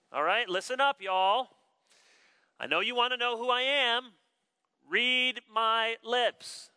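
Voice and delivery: emphatic proclamation. Acted. John addresses the crowd asking who he is, emphatic proclamation. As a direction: announce it with absolute clarity. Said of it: "He proclaimed it with absolute clarity".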